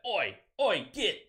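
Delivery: Oi barked out high and loud, orc style; high, loud cockney greenskin greeting; cockney orc yell. Retold or imitated. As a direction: shout it like a rowdy Warhammer orc. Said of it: "you know, like that".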